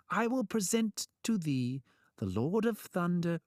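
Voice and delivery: high, ceremonious trickster proclamation; mock-grand. A narrator's reading. Trickster lightens the mood for Thor, mock-grand.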